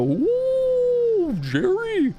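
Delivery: very high, cooing falsetto toilet voice; high-pitched sentient toilet, drawn-out and singsong; imagined talking toilet. Acted. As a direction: coo it in a silly falsetto, delighted with the visitor. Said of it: "That's how I imagine a toilet would sound".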